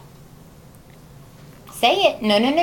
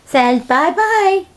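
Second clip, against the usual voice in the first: slow loud sing-song; 9 semitones higher, 8 dB louder, much slower than usual. Shown as a demonstration. Coaxing child to say bye-bye.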